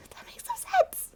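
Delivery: high, breathy laughing whisper; whispered disbelief. Real, not acted. Reacting to her old plot hole, whispering in disbelief. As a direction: gasp it out in disbelief. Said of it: "laughing disbelief".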